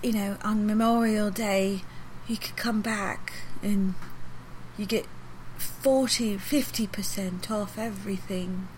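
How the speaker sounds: lightly voiced old lady, slow and gentle; slow sweet old English lady. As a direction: drag each word out like a sweet little old shop lady. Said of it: "she's moved super slow".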